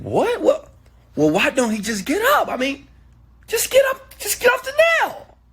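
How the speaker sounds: high, squealing mock disbelief; exasperated friend. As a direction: play a baffled friend, rising to a very high shout. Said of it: "the other man's friend said".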